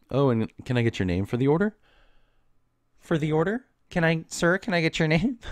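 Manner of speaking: high, chirpy barista sing-song; mock-cheerful barista asking for a name; sweet customer-service voice.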